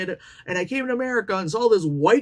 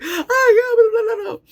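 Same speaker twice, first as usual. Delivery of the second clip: mock sobbing; 7 dB louder than usual. Girl breaking down in bathroom.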